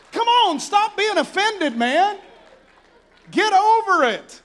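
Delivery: high, loud, slow exhortation; preaching at full force. Real, not acted. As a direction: push the crowd hard to let it go.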